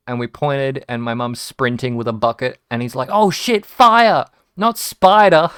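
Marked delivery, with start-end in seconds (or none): 3.1-5.5 very loud, high sudden realisation; retold panicked shout. Dad realising the house is on fire, acted alarm. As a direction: shout it as the danger dawns.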